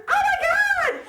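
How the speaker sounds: very high, loud shriek; shrieking re-enactment of horrified embarrassment; retold scream. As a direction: scream it out in mock panic. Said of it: "So I scream, of course".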